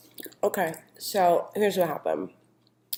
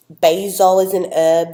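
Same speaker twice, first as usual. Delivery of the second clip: put-on British accent creeping in; sudden British accent; 10 dB louder than usual. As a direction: slip into a posh British accent for "basil is an herb". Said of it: "I don't know why I went British".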